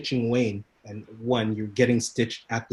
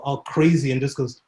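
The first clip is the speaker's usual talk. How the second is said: ordinary talk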